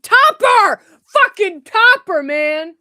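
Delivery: high, loud burst of recall; excited, emphatic yell; triumphant shout. Real, not acted. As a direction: shout it out as it comes back to you. Suddenly remembers the penguin's name.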